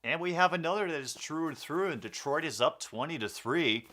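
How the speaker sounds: energetic sportscaster call; play-by-play commentary. Acted. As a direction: call it like a live broadcast. Calls the game play-by-play.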